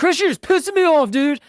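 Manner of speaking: high, loud hammed-up irritated wife; mock angry wife. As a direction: play the wife snapping at her husband, overdone. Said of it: "Jermichael's embarrassed".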